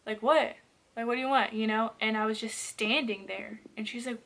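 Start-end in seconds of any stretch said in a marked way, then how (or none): 0.0-1.5 loud, irritated "what"; acted-out annoyed questioning. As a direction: demand an answer from a silent intruder. Sister confronting a silent figure.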